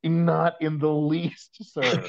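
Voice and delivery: slow, drawn-out earnest reply; slow mock-solemn reassurance to the hero; earnest denial. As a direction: stretch it out with mock sincerity. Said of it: "I'd be like".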